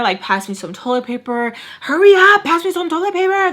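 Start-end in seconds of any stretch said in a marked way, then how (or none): 1.8-3.5 high, urgent re-enacted demand; freaking out. Telling friend to pass toilet paper, high frantic urging. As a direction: mimic a panicked hurry-up, voice shooting up. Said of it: "Like, I'm freaking out".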